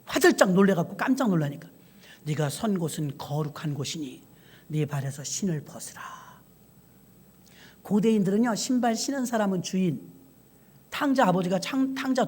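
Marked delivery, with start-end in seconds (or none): 2.2-6.0 low, soft and solemn; a quiet, holy command from above; hushed voice of God. Acted. The hushed voice of God telling Moses at the bush to remove his shoes.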